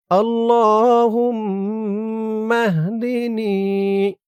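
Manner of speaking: drawn-out, melodic dua chanting; slow Quranic-style recitation of a prayer; slow Arabic recitation. Shown as a demonstration. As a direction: recite each syllable long and lilting.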